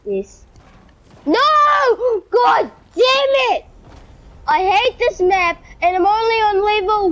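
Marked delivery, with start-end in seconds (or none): 1.2-3.6 real exasperated gamer outburst; frustrated shout. Real, not acted. Frustrated outburst while failing the game.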